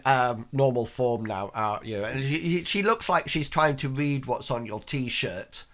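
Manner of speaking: usual speaking voice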